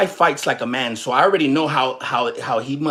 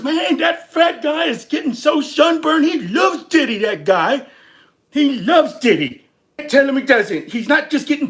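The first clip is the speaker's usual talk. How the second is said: higher, louder, hammed-up bystander jeering; mock bystander voice; 9 semitones higher, 4 dB louder than usual. Streamer mimicking a crowd mocking a paid supporter, loud and high. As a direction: shout it like a heckler on the sidewalk. Mimicking onlookers at [name] trial.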